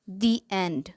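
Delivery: "the" said "thee" before "end"; long ee in "the", then "end". Shown as a demonstration. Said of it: "इसे दी बोला जाता है". ("the" को "दी" बोलें)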